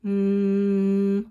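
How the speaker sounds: "mm" as a long, steady hum; closed-lip hum on "mm", one note; stretched-out m. Shown as a demonstration. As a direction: lips together, hold "mm". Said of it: "This sound says".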